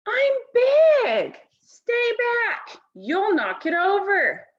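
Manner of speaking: put-on a kid guarding his block tower; high-pitched bossy boy voice; bossy little boy.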